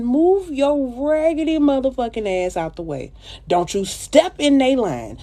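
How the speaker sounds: a bit of diva attitude; sassy telling-off. Acted. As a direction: shoo them out of the way with attitude.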